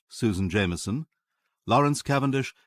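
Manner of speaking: ordinary talk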